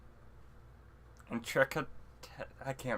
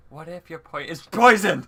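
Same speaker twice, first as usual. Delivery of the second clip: sudden alarm; 9 dB louder than usual. Shown as a demonstration. Player showing how they planned the warning, sudden outburst. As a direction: break off into a loud cry of poisoned. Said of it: "I was gonna plan on doing, like".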